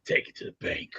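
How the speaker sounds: low, hushed quote of a wrestling villain; Million Dollar Man. Acted. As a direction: drop low and let it sink to near silence. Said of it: "As the Million Dollar Man once said".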